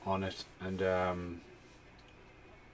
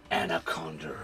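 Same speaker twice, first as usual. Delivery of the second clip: whisper; 4 semitones lower than usual. Acted. Whispered title.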